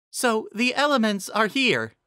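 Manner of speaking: high pony voice; Cheerilee's voice. A narrator's reading. Narrator voicing Cheerilee announcing the elements, high.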